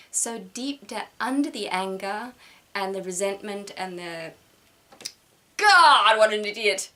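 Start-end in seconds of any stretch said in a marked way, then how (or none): 5.6-6.9 quoting her own angry reaction, loud; mock angry outburst. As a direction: spit it out like a flash of anger.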